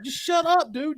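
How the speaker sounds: high-pitched imitated hushing plea; retold high, exasperated appeal; trying to chill things. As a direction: imitate a cop calming someone down. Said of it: "other cop's trying to chill".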